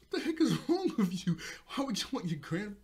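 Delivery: high, loud outraged mom, half laughing; comic telling-off with a laugh in it; mock scolding. Mom scolding her daughter for morbid talk, mock outraged.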